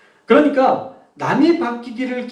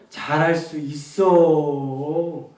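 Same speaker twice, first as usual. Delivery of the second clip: low, slow 잘할 수 있어 with a long wavy ending; riding the wave; 6 semitones lower, much slower than usual. Shown as a demonstration. Coach leading an exercise, wave-like drawn-out tone. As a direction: let the words roll and linger like a wave. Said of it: "이 파도를 타야 돼요".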